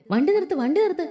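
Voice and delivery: Leena yells at the driver to stop the car; screaming to stop. Acted. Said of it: "Leena അലറിപ്പോവാൻ തുടങ്ങി".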